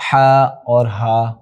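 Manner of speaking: ہا اور ہا drawn out slowly to contrast the two h sounds; teacher slowly contrasting two h letters; slow letter demo. Shown as a demonstration. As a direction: stretch each ہا so the difference shows. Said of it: "ہا کہاں سے ادا ہوتا ہے".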